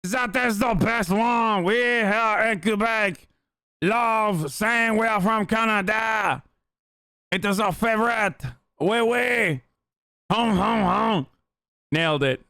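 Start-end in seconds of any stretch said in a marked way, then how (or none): 0.0-11.2 slow, hammed-up Quebecois accent, half shouted; mock Quebecois accent. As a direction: ham up a bad Quebecois accent, drawn out. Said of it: "Except for my Quebecois!".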